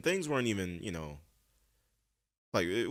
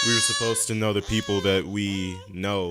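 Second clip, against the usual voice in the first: much louder, insistent delivery; talking over noise; 2 semitones higher, 10 dB louder than usual. Real, not acted. Finishing a point over a crying child, raised voice. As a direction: keep the point going over the racket.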